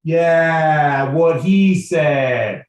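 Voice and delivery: loud, very drawn-out comic agreement; mock backup yell, slowly dragged; dim sidekick Henry. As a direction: stretch it out high and loud as the tagalong. Acting Henry backing up Mason loudly.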